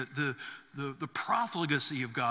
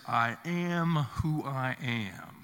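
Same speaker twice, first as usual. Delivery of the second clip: slow, measured divine pronouncement; God's name, solemn; 3 semitones lower, slower than usual. Acted. Preacher voicing God's name to the congregation, slow and solemn. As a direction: intone it with weight.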